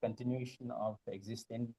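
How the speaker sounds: very soft, far from the mic; barely audible, away from the microphone; too quiet. Real, not acted. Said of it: "Some of your voice is very low".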